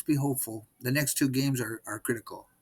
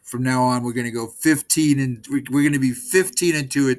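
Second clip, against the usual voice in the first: rallying cheer; 8 dB louder than usual. Real, not acted. Rallying fans at the end.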